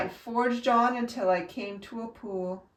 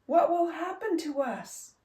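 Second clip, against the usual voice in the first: hollering; 7 semitones higher than usual. A narrator's reading. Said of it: "they hollered".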